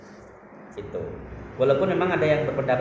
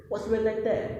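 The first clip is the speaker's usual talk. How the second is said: much higher, polished British-style question; British accent imitation; 11 semitones higher than usual. Quoting the Filipina host one more time, British accent. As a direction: lift the whole question up high, British-like. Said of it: "Dia bilang gitu".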